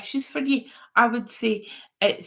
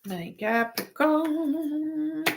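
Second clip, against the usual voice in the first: higher, very slow and stretched; drawn-out sing-song; 6 semitones higher, much slower than usual. Pretend. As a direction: sing the sign name out slowly. Repeats the sign, second time drawn out.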